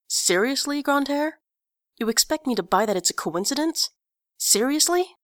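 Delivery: high, incredulous rising questions; manic disbelief. Acted. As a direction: push the disbelief up to near-mania. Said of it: "almost manic in disbelief".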